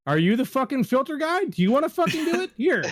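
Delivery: hammed-up fed-up worker snapping; pretending to be the annoyed filter guy; mock exasperated rant.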